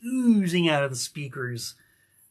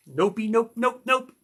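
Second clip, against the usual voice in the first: playful nopes; 6 semitones higher than usual. Real, not acted. Playful denial.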